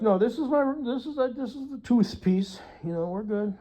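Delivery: high, light fake-innocent explaining; mock innocent. Explaining the tooth piece to the partner, high mock innocence. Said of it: "I'd be like".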